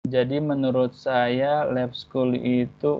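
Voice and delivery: dragged, slow answer; too slow. Shown as a demonstration. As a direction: drag the words out too slowly. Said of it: "waduh, lama tuh".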